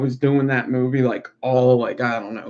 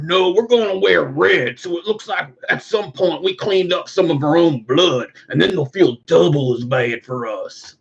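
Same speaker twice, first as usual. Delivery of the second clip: higher, lightly put-on outlaw voice; smug plan explained; cowboy skit voice; 4 semitones higher than usual. The cowboy explains the red sashes, in a higher smug voice.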